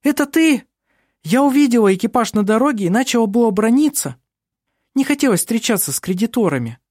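put-on high, bubbly girl voice; bright chatter of a lively girl; lively young woman voice. A narrator's reading. Narrator voices Vinga.

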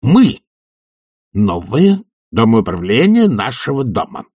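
high, emphatic opening, then controlled; restrained fury. A narrator's reading. Committee head introduces the new management, with restrained fury. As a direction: announce yourselves with anger held in. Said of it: "в сдержанной ярости заговорил черный".

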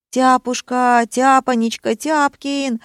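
high-pitched, wailing pet names; overwrought calling. A narrator's reading. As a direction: call out desperately, pitched high. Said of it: "надрывался Тоша". (надрывные причитания)

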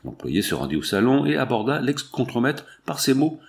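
plain reading voice

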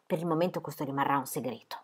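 hushed, low confidential aside; secretive aside. A narrator's reading. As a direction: drop the voice softly to keep a secret. Narrator keeps the gift secret.

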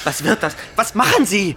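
high, loud alarmed cry; alarmed shout. Acted. Foxfinder horrified at Samuel's rabbit trap, shouting.

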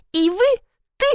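high-pitched, halting recognition; faltering question. A narrator's reading. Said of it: "Она запнулась". (запинаясь от удивления)

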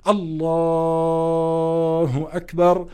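الله stretched very long, then أكبر after a pause; drawn-out Allah. Shown as a demonstration. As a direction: hold the word الله long while forming the intention. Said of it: "بتمد لفظ الجلالة".

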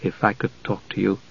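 hushed, low request; subdued murmur; low tones. A narrator's reading. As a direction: ask privately, very softly. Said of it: "Palin said in low tones".

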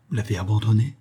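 usual speaking voice

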